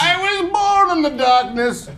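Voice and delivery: high, slow theatrical villain voice; put-on villain voice. Man clowning before a demonstration, mock villain voice. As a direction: stretch it out in a high, hammed-up voice. Jokey film-villain quote while set up.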